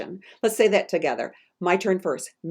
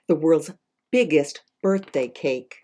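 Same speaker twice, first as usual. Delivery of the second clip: ordinary delivery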